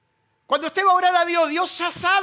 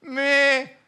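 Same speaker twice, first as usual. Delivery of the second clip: sheep bleat; 3 dB louder than usual. Pretend. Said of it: "Moisés le decía".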